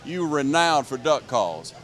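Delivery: announcer voice. Acted. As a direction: introduce the guest like an emcee. Host introduces guest to crowd.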